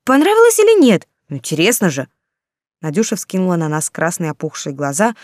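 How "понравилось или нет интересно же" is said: much higher, louder nosy question; eager pushing. A narrator's reading. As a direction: press her eagerly for gossip. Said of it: "не унималась Ирка". (настойчиво, с любопытством)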